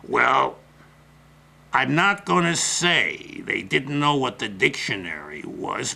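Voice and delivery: ordinary delivery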